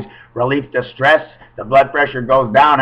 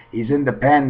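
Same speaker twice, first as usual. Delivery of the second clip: ordinary talk, nothing marked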